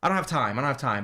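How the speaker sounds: ordinary talk, nothing marked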